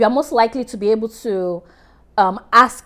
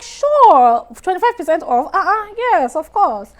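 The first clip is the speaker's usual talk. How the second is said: much higher, louder greedy "sure"; mock delighted customer; 8 semitones higher, 4 dB louder than usual. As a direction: ham up grabbing the discount. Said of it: "And then you're like".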